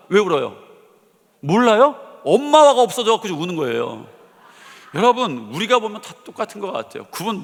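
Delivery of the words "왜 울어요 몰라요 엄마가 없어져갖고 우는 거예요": preaching louder with each example; rising preacher's fervor; building sermon. Acted. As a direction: press the point harder each round.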